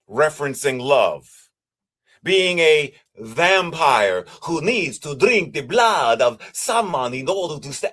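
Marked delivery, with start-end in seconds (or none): 2.2-7.9 lilting mock Transylvanian vampire accent; Christopher Lee Dracula accent. Speaker illustrating a hungry ghost as a bloodsucker, Dracula accent. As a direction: put on a hammy Dracula accent. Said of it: "I don't know why I did that accent".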